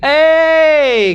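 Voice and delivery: sharp high shout of "Hei"; voicing a neighbour's loud hail; shouted call. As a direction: call out loudly from behind a wall. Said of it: "Ada orang berteriak dari belakang dinding".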